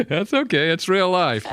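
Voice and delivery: real chuckle carried into the words; laughing. Real, not acted. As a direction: laugh it off as you speak. Laughing over an interruption by barking.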